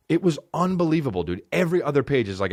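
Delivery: ordinary talk, nothing marked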